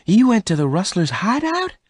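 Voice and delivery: very high-pitched, appalled question; horrified. A narrator's reading. Bess learns Venters visited the rustlers, horrified. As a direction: ask it high, aghast. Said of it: "Bess asked in horror".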